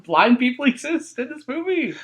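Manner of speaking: mock the sequel's big reveal; mock announcement. Mocking the sequel.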